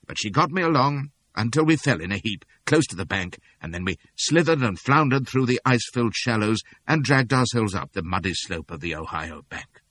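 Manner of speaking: usual speaking voice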